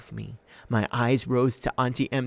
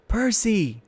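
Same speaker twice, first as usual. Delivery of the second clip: shouting; 11 semitones higher, 5 dB louder than usual. A narrator's reading. Shout in battle.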